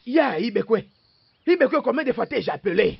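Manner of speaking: loud, high call across the room; calling out loudly. Acted. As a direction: shout his name to get his attention. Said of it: "tu es là en train de crier mon nom". (appel crié, fort et aigu)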